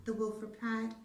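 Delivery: usual speaking voice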